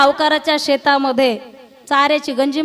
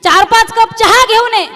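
retold yell; 7 semitones higher, 6 dB louder than usual. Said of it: "ओरडून याने सांगितले".

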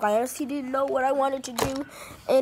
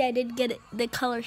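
lip stretched showing teeth; talking while showing braces; finger pulling lip aside. Real, not acted. Seen 0: finger hooks mouth corner aside to show braces, 0.6–1.7s.

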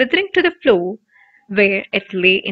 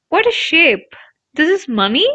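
amazed; 6 semitones higher, 4 dB louder than usual. A narrator's reading. Ron amazed by coin.

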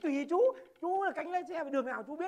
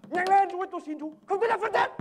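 desperate begging; 7 semitones higher, 10 dB louder than usual. Acted. Passenger begs robber.

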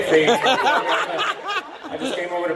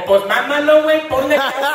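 high, mocking Valley homie voice; Valley buddy teasing; 9 semitones higher than usual. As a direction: tease like a crude friend from the Valley. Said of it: "your camaradas right away is gonna go".

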